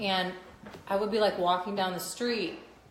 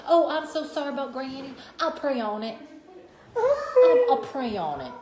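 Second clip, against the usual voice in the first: Southern sympathy; 6 semitones higher, 5 dB louder than usual. Retold or imitated. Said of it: "it's like".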